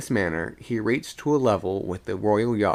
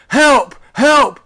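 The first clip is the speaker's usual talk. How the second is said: very high, loud shouts; desperate high-pitched scream; screamed cry for help; 13 dB louder than usual. A narrator's reading. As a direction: yell for help from far aloft. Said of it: "rose from a shout into a scream".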